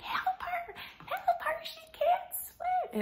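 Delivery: very high, squealing mock cry; imitated student alarm. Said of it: "students is like".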